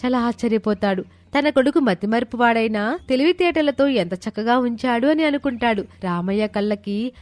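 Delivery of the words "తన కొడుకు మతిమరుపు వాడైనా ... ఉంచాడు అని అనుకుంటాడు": ordinary delivery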